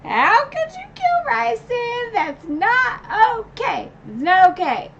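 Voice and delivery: high-pitched, loud mock outrage at the author; a hammed-up fan meltdown, slow and shrill; mock outraged rant. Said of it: "then I was mad 'cause I was like".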